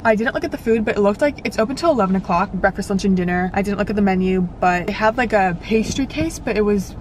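ordinary delivery

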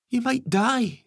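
put-on very high, loud boy's outburst; alarmed exclamation. A narrator's reading. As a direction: exclaim it as a frightened child. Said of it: "exclaimed Ben".

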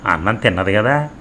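plain reading voice

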